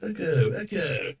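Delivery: fading reverb echo; distant repeating echo; metallic flutter echo. Real, not acted. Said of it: "the echo".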